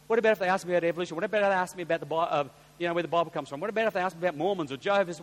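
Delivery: fast, fretting string of questions; worried rapid questions. Acted. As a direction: fire off anxious what-ifs in a rush. Acts out worried would-be witness firing questions.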